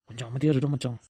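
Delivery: low, soft warning to a friend; hushed shushing. Acted. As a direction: quietly shut your friend up. Hushing friend while teacher is near. (அடங்கிய குரலில் அமைதிப்படுத்துதல்)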